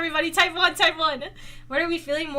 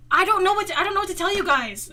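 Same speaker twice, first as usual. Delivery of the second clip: ordinary talk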